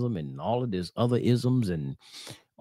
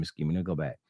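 ordinary talk

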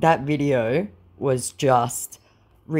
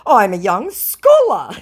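slow, higher, louder boast; mock scammer voice; 6 semitones higher, 8 dB louder, slower than usual. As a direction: ham up the proud claim. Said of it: "he says".

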